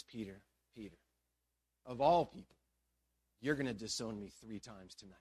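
low, soft, sorrowful address; hushed, grave foretelling. Acted. Jesus looks at Peter and foretells betrayal, hushed. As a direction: let each phrase drop, with pauses between.

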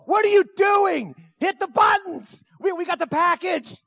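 high, loud exasperated shout; frantic yelling. Retold or imitated. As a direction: yell at your wife to act now. Acted yelling at inactive wife.

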